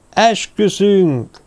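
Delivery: high, slow chanted oath; a crowd swearing as one, drawn out; druids roaring an oath. A narrator's reading. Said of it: "zúgták a druidák".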